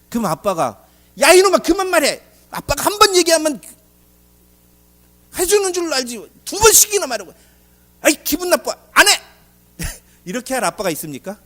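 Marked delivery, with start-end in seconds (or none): 1.2-9.2 high, loud scolding dad; cranky dad telling his pestering son off; annoyed father. Acted. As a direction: snap at the kid like an irritated father. Voicing an annoyed father refusing.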